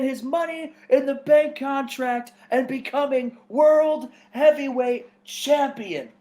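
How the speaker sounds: a light hyped-up promo shout. Acted. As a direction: sell it to the cheering crowd. Wrestler cutting an excited promo.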